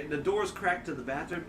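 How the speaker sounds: ordinary talk, nothing marked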